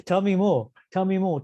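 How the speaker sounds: lightly acted hunger for details; eager questioning. Thief pressing the devotee for details, eager.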